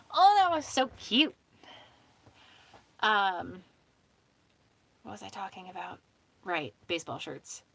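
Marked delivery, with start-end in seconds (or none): 0.1-1.3 high, loud gush of delight; delighted cooing. Real, not acted. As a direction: gush loudly over something adorable. Delighted by the pet.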